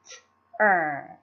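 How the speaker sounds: "t-er" said alone as one syllable; syllable sounded out. Shown as a demonstration. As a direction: sound out "t-er" by itself. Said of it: "pronounce the second syllable".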